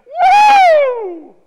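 long, high crowd "Woo!"; loud, soaring cheer; amazed whoop. Retold or imitated. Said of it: "were amazed and said to each other".

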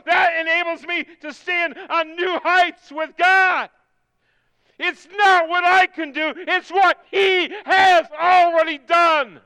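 high-pitched, shouted preaching that climbs and holds; full-force preaching. Real, not acted. Preacher testifying what gets them up, full-force preaching. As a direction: let it rip from the pulpit, loud and high.

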